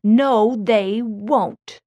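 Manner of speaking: very loud, forceful contradiction; bully's loud, emphatic veto; angry refusal. A narrator's reading. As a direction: shut it down loudly. Bill refuses, menacing the boys.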